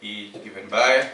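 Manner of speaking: slow, word-by-word dictation with long pauses; dictating notes. Acted. Teacher dictating a definition to the class, slow dictation. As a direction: dictate each phrase slowly so students can write it down.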